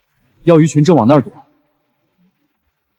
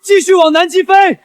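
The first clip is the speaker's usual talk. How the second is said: commanding shout. Acted. Urgent order over comms.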